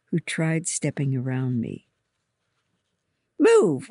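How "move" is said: high, louder impatient command; sudden pushy cry; impatient order. A narrator's reading. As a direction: order someone out of the way sharply. Girl snaps at one in way.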